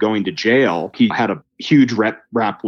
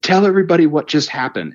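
higher, fast eager command; thrilled to show him off; excited; 8 semitones higher than usual. Retold or imitated. Urging Joe to tell the insurance table, excited. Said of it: "I'm so just ecstatic".

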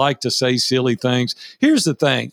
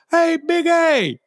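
high, drawn-out mock holler; high-pitched "hey you" call; slower than usual. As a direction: imitate a stranger hailing someone, high and slow. Stranger calling out in airport.

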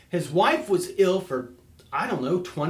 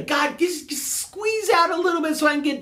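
high, small pleading voice mocking a timid prayer; mock meek prayer; 7 semitones higher than usual. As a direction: beg God for scraps, half-joking.

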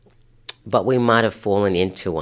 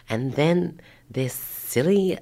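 usual speaking voice

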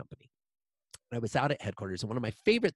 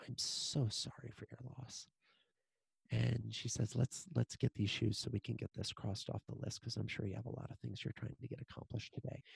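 hushed sympathy; 9 dB quieter than usual. Retold or imitated. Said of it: "the call center rep is like".

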